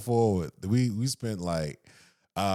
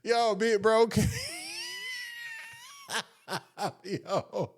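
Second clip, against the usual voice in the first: laughing; 10 semitones higher than usual. Real, not acted. Laughing hard telling friend about big bro's one drink. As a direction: crack up telling it.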